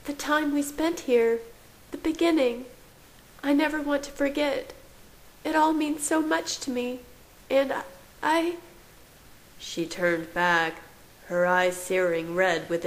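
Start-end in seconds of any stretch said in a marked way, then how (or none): 0.1-8.6 overwhelmed woman in a high register; emotional woman. A narrator's reading. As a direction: voice her high and faltering at the end.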